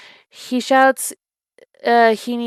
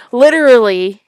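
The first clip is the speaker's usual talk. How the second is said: emphatic; 3 semitones higher, 9 dB louder than usual. Real, not acted. Emphatic agreement after scolding.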